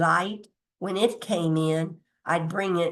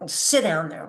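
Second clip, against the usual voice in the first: ordinary talk, nothing marked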